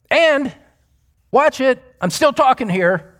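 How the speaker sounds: insistent voice, starting high; Paul. Acted. Preacher speaks as the apostle still making his point, insistent. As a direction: hold the floor mid-sentence, emphatically. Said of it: "I'm talking as though Paul is speaking".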